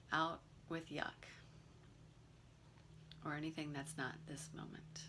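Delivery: calm, unhurried meditation guide with long pauses; guided meditation. Real, not acted. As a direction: gently lead them through the breathing, leaving room between phrases.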